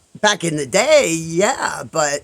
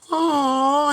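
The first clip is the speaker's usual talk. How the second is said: high, drawn-out aww of pity; sympathetic aww; 9 semitones higher than usual. Retold or imitated. Friends seeing an injured squirrel, high pitying coo. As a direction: imitate friends cooing with pity.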